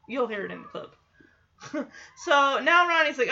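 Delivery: ordinary talk, nothing marked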